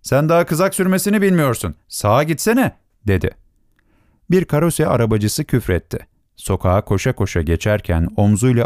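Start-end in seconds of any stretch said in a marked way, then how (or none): 0.0-2.8 high-pitched irritated reprimand; angry officer. A narrator's reading. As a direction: scold the driver, voice raised in pitch, not volume. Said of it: "Kızaktaki subay kızarak". (kızgın subay, tiz sesle azarlama)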